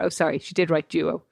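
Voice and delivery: normal talking voice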